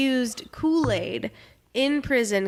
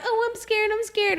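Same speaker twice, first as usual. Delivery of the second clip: scared puppies; 10 semitones higher than usual. Retold or imitated. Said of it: "and they're all like".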